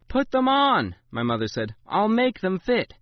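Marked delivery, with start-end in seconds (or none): 0.1-1.0 loud, high commanding mother, falling at the end; final word, flatly insisted; firm order. A narrator's reading. 1.9-2.9 slow, higher, different-voiced mother; calm mother. A narrator's reading.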